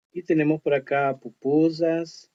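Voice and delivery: ordinary talk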